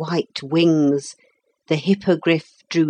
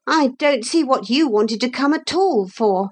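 put-on higher boy's blunt objection; downright; 8 semitones higher than usual. A narrator's reading. Philip objecting to the unwelcome lady, downright. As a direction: say it bluntly in a lightly voiced boy's tone. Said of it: "said Philip downrightly".

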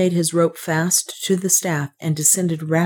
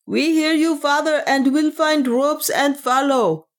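cried in answer; 10 semitones higher than usual. A narrator's reading. Priest answering Nam from above, a high cry. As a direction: call back high, slowing at the end. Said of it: "a voice cried in answer".

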